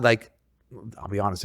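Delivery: usual speaking voice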